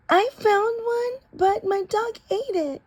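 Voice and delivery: put-on high child's voice, sheepish; high kid voice making an excuse; kid's excuse. A narrator's reading. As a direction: squeak out a lame excuse.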